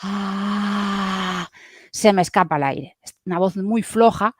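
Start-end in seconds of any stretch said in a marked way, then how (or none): 0.0-1.5 soft ah with the air leaking out; weak airy ah. Shown as a demonstration. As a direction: let the breath escape through loose cords. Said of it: "si es demasiado flojo, aproximo poco".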